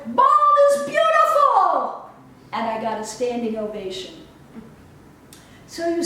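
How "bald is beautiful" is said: high, loud and slow, a proud proclamation; triumphant declaration. Real, not acted. Taking off her hat before the group, a proud shout. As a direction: announce it to the room like a victory cry. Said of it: "and declare".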